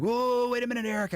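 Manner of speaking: high, startled whoa; a retold double take. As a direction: pull up short, taken aback. Startled reaction in retold scene.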